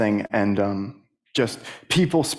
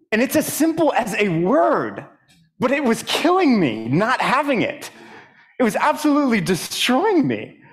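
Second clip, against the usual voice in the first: higher, pressing, heartfelt emphasis; emotional testimony; 10 semitones higher than usual. Real, not acted. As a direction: pitch climbing high with real feeling.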